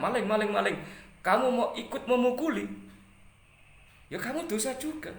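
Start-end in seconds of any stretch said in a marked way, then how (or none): 0.0-0.7 high, loud repeated call; imitated thief alarm. Streamer re-enacts someone raising a false alarm, mock shout. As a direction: mimic a person crying out thief. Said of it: "Ketika orang teriak".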